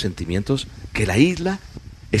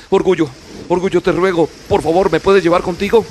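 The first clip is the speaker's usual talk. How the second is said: high, louder begging; pleading Love; 10 semitones higher, 6 dB louder than usual. Acted. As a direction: plead from up high, pressing harder. Love begs Pride.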